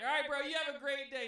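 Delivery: high, loud retold send-off; calling after someone. To the stranger walking away screaming, called out loudly. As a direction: shout a farewell at a retreating back.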